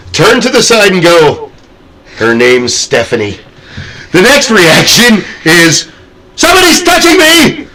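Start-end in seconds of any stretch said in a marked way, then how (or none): 2.2-3.4 low, unimpressed mock reply; deadpan. Imitating a man tapped on the shoulder in a haunt, low deadpan. Imitating unfazed guest's reaction. 6.4-7.7 very high-pitched squeal; mock panic. Imitating a haunt guest tapped on the shoulder, high panicked squeal.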